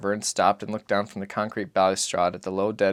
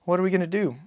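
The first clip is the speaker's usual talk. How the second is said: put-on high, light child's voice; a small boy asking his father; boy's voice; 9 semitones higher than usual. Narrator voices the boy asking Papa, high and childlike.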